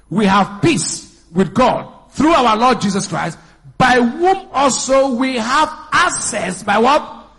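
loud, high, slow emphatic sermon; full-force preaching. Real, not acted. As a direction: belt out the scripture, drawing out key words.

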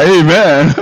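amen melting into a laugh. Real, not acted. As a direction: chuckle through it.

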